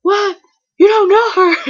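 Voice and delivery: high, loud mock disbelief; incredulous mom. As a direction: imitate a mother blurting in shock. Mom's disbelief, imitated.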